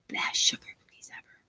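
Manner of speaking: breathy whisper of a fond memory; hushed, wistful whisper; whispered fondly. Real, not acted.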